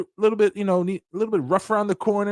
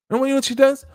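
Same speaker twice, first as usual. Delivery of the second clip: usual speaking voice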